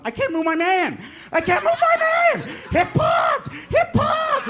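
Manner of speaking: very high, rising panic shouts; panicked yelling. Retold or imitated. Brother panicking in game.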